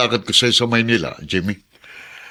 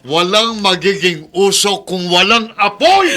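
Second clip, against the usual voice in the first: much higher, slow and loud, every word hammered; husband shouting the proverb at his wife; angry slow shout; 11 semitones higher, 5 dB louder, slower than usual. Acted. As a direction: bellow the proverb at her. Husband angrily rebuts wife.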